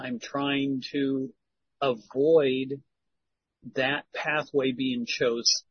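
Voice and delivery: ordinary talk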